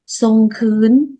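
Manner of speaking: sông khướn said slowly with a rising end; Lao word. Shown as a demonstration. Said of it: "Từ sông khướn này". (đọc chậm từ sông khướn)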